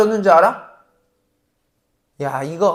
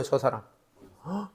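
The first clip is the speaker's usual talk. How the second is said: ordinary talk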